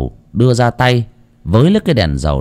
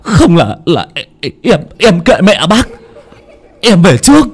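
high, louder, breathless terrified cries; panicked yelling while fleeing; 11 semitones higher, 5 dB louder than usual. Acted. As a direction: howl in terror as something chases you. Tính fleeing in terror from ghost.

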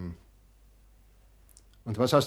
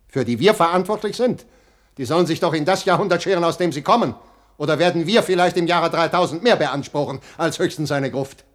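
louder, rising outrage; angry rant; 4 semitones higher, 7 dB louder than usual. Acted. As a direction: hammer home his scorn. Father argues heatedly with daughter. (empört und laut)

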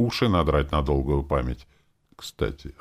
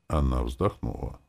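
ordinary delivery

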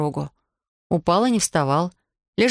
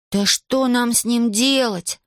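high, drawn-out exasperated cry; 6 semitones higher, slower than usual. A narrator's reading. Паша despairs over Иван lying there, crying out. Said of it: "закричал Паша".

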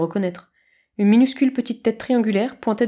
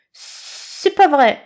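slow hissing snakelet; 7 semitones higher, 5 dB louder, much slower than usual. A narrator's reading.

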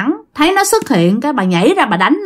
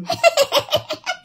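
very high, softer staccato cackle; imitated ghostly cackle; 6 dB quieter than usual. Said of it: "vừa ăn vừa cười".

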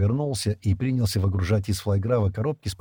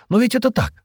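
high, louder insistence; insistent; 13 semitones higher, 5 dB louder than usual. A narrator's reading. Джема argues back at Стэн, insistent. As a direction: press the point hard. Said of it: "настаивала Джема".

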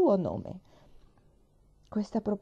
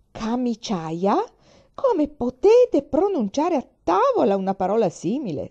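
put-on appalled old grandmother scolding at the table; scandalized snap; 7 semitones higher, 8 dB louder than usual. A narrator's reading. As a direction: snap back at the word in shock. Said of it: "scattò a dire".

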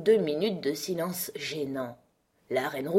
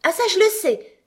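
high, loud burst of eagerness; eager cry; 9 dB louder than usual. A narrator's reading. Alice knows how bread is made, crying out eagerly. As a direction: jump in brightly, pitched way up. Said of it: "s'écria vivement Alice".

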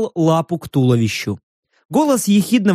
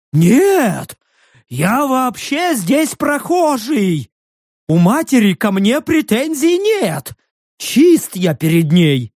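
high earnest creature voice, slower; high creature vouching; 6 semitones higher, slower than usual. A narrator's reading. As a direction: vouch for your rude bear, eager beast. Creature excusing itself.

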